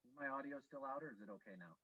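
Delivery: muffled, distant audio; like through a wall; far away. Real, not acted. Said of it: "You sound so far away".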